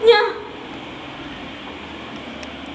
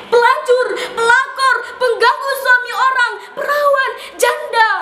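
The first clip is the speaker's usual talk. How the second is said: bitter angry listing; 5 semitones higher than usual. Acted. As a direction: fling each word out hard. Listing labels thrown at women.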